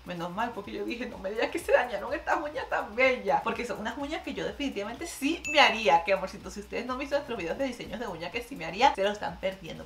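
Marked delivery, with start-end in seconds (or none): none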